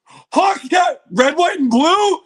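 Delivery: high, loud, slow shouting in disbelief; excited shout. Real, not acted. Streamer hypes the reveal to chat, shouting excitedly. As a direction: yell it out, thrilled.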